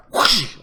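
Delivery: loud, breath-made whoosh; imitated arrow whoosh. Game master showing players the arrow, imitated whoosh. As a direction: make the sound of an arrow flying past.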